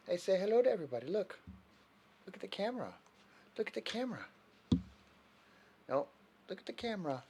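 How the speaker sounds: high pet talk. Real, not acted. Coaxing dog to face camera.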